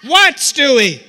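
high, loud exasperated snap; exasperated mother. Retold or imitated. Pestered mother to her little son, exasperated shout. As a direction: burst out at a pestering child.

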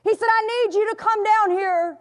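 calling his wife from the foot of the stairs; mock yell up stairs. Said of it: "he yelled up the stairs".

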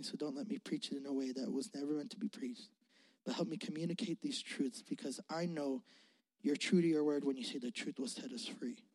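soft, low prayer voice. Real, not acted. Pastor praying softly before preaching. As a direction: pray quietly over the message.

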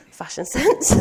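real laugh breaking through the words. Real, not acted. Preacher to congregation, mocking her old clothes, laughing. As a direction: giggle at yourself, high and loud.

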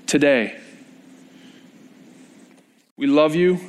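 earnest congregational prayer, building toward the middle; closing prayer. Acted. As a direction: pray aloud for the whole room, sincere and rising.

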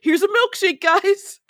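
high, put-on offering voice; mock cheery father. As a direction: pitch it up like a silly, sweet dad. Voicing the father offering milkshakes.

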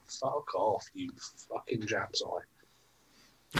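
slow whispered insult; muttered insult. Real, not acted. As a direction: breathe the insult out slowly.

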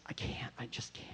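nervous whispered refusal. Retold or imitated. Narrator refusing to hide the bag, whispered. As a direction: whisper it so nobody on the bus hears.

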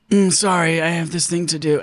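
weary, put-upon brush-off; exasperated. Retold or imitated. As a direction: sigh it off like a chore. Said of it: "she always talks in that really, like, exasperated voice".

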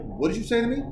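stern dad. Retold or imitated. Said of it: "I was like".

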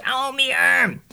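high yelp of pain; sharp high kid's yelp; pained scream. Retold or imitated. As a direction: cry out as the sleeve rips. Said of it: "and he screams".

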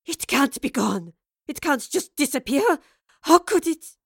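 rising to a loud, high cry; frantic denial building to a shout; rasping disbelief. Acted. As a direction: rasp out the panic and build to a shout. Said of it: "then rasped".

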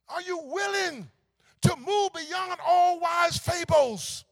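loud, slow, high preaching shout; rising sermon cry, slowed and hammered; preaching at full force. Real, not acted. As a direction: drive it home from the pulpit.

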